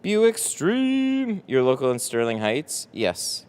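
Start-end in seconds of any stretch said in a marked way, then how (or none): 0.0-1.4 high, excited call-out; stream greeting. Real, not acted. Streamer opens the live stream to chat, high excited call. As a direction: greet the chat with a high, pitched-up shout of joy.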